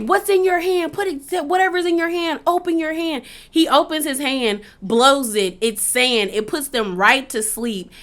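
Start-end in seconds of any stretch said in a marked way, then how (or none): 0.0-3.2 higher-pitched imitated police commands; urgent orders. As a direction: act out an officer demanding compliance. Retold guards with guns drawn giving orders.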